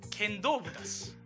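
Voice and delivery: ordinary talk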